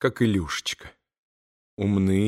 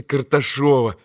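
ordinary delivery, nothing marked

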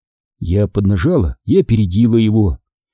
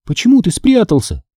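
high, pressing question; insistent questioning; 9 semitones higher than usual. A narrator's reading. Sister questioning her hiding brother, insistent. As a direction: press for an answer, pitched up. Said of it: "допытывалась я".

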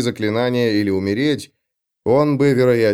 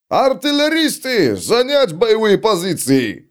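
through a tube; 9 semitones higher, slower than usual. A narrator's reading. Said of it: "выкрикивал Макайсон".

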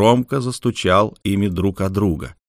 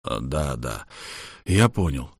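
somewhat taken aback, trailing off; disconcerted; 7 semitones lower, 6 dB quieter than usual. Acted. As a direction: mumble it, caught off guard. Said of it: "несколько обескураженно сказал капитан".

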